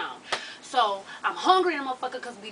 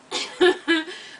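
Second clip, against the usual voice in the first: laughing; 5 semitones higher, 4 dB louder than usual. Real, not acted. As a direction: burst of laughter. Real laugh.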